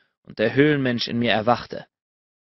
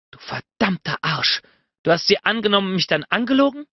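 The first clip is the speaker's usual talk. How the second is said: a higher, faster woman's outburst; angry accusation; 7 semitones higher than usual. A narrator's reading. She confronts the lying Bennett, angry and rushed. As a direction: hurl it at him, rushing the second line.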